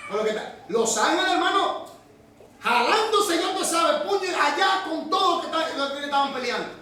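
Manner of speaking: breathless pulpit storytelling, laughing at moments; preaching at full force. Acted. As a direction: tell the heavenly battle with fire. Preacher describes the war in heaven.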